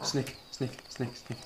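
soft repeated warning; quiet snake alert; hushed alarm. Real, not acted. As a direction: drop to a hushed, nervous repeat. Spots a snake in game.

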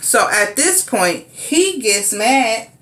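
ordinary talk

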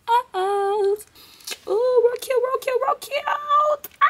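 very high, drawn-out cutesy squeal; playful squeal. Real, not acted. Vlogger showing viewers their new toes, playful squeal. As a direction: coo in a tiny, sky-high voice. Gushing over freshly done toes.

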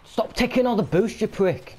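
high, loud angry shout; furious gamer shout; angry yell. Real, not acted. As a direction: snap at the teammate hogging boost. Teammate steals boost.

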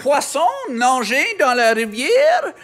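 goofy mock Québécois French for the caller; hammed-up Québécois French. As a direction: ham up a silly Québécois French accent. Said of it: "parce que je suis un Québécois".

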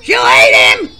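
loud, high alarmed shout. Acted. Contestant warning about the granny, loud alarmed shout. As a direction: cry out in alarm.

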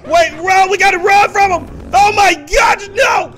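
loud frightened shrieks, high; panicked screaming. Real, not acted. Chased by Chucky, screaming.

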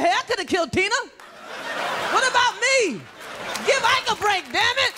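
high, whiny self-pity. Retold or imitated. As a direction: plead for sympathy in a high voice. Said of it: "[name] walking around going".

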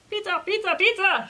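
high, eager crowd chant; shouting alpacas. A narrator's reading. Famished alpacas race to Llama's house, high acted shouting. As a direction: squeal the word over and over. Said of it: "shouted the alpacas".

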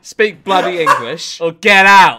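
mock gruff yell. Acting gruff bloke to alien.

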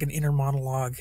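ordinary talk

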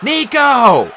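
high, loud urgent call; yelled name. A narrator's reading. Hero calling to a friend across battle, high yell. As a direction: shout to get his attention in battle. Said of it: "I yelled".

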